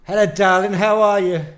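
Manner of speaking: a hammy posh 1940s gent; old British film voice. Acted. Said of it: "like the old British films".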